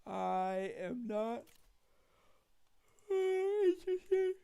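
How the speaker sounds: very slow, then high and loud put-on refusal; mock haughty voice. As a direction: play a snooty character saying no. Put-on voice to chat.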